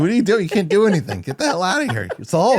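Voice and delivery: loud, high-pitched frantic yelling in imitation; imitated screaming. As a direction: yell it like the screaming hero. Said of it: "He's just screaming".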